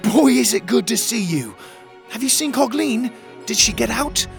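high, excited little hero voice; bright high-pitched delight; joyful reunion. Acted. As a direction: gush eagerly to a found friend. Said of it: "Pockets laughed".

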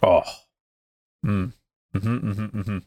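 blissful mm after a sip; rapturous tasting food. Real, not acted. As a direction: savor it with pleased hums. First sip of the beer.

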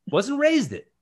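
ordinary talk

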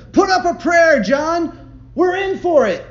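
very high, very loud panicked yell; terrified shout. A narrator's reading. Man yelling to John while fleeing the bull, terrified. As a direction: scream it as the bull closes in. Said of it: "the one shouted to the other".

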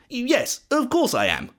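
high-pitched, insistent yes; lightly acted fibbing son; lying. A narrator's reading. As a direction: insist you are who you claim. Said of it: "lied Jacob a third time".

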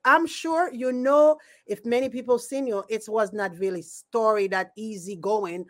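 ordinary delivery

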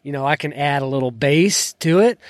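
ordinary talk, nothing marked

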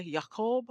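"Jacob" said as Yaakov, soft Y start; quiet Hebrew "Jacob"; Hebrew pronunciation. Shown as a demonstration. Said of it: "as the Hebrew people say".

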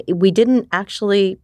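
ordinary talk, nothing marked

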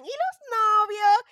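loud, high-pitched mock pestering; nosy relatives' question. Nosy relatives quiz their niece about boyfriends, loud mock pestering. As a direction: blare it out high, like prying relatives. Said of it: "entonces empiezan".

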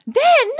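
"then" jumps way up in pitch; suspenseful emphasis. Real, not acted. Teasing a cohost with the next twist, high "then". Building suspense by repeating word.

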